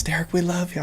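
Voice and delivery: high, soft mock crowd cheer; hushed, high-pitched imitation of cheering fans; imitating adoring fans. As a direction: put on a high little fan voice. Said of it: "everyone's going nuts".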